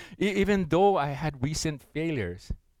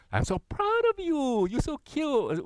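put-on very high, sing-song baby talk; cooing pet talk; 10 semitones higher, slower than usual. Talking sweetly to the dog, very high coo. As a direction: coo at her like a beloved pet. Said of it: "It's the tone of your voice".

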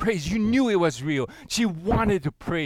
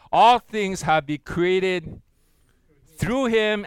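congregational scripture reading; 2 semitones higher, 4 dB louder than usual. Pretend. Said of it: "Won't you read that with me?".

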